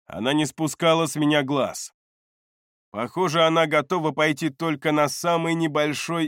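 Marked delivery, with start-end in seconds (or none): none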